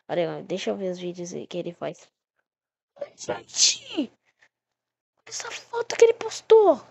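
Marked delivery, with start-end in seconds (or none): 3.2-6.8 shocked shriek. Real, not acted. Shocked by creepy photo.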